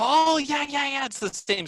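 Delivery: imitating excited student. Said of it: "And they go".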